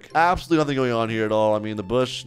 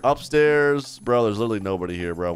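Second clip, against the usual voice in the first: ordinary talk, nothing marked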